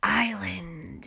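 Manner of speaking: softly drawn-out call, falling at the end; coaxing call with a falling tail; calling a cat. A narrator's reading. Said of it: "she called, like it was a cat".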